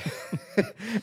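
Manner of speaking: a quick high laugh at himself; burst of laughter. Real, not acted. A guest laughing at himself while telling the story, quick high laugh. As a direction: laugh it off, amused at the memory. Laughing at smelly self in store.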